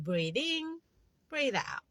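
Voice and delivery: calm meditation cue; soft breathing cue. Shown as a demonstration. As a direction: guide the breath softly. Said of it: "You just say that in your mind".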